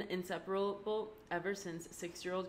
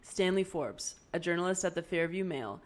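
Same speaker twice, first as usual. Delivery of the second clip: louder, steady recorded log voice; spoken log entry, firm and a bit louder; project log entry; 6 dB louder than usual. Acted. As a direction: dictate it into the recorder like a case file. Recording her capstone project log.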